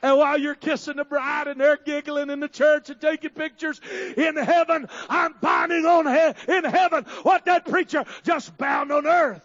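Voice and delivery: loud, slow climbing preacher's shout; full-throated pulpit shout; preaching at full force. Acted. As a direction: drive it home to the congregation.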